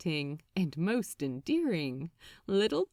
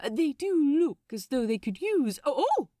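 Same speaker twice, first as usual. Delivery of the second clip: startled cry; 6 semitones higher, 4 dB louder, faster than usual. Acted. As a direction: voice leaps higher and louder as he is cut off. Angel cut off by a sudden kiss.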